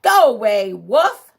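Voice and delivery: lightly voiced pig yelling; yelled back. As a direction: shout him away from the door. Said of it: "He yelled back".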